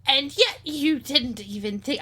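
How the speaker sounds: put-on light Cockney old lady; a hint of Cockney, scolding; Cockney accent. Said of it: "I went Cockney again".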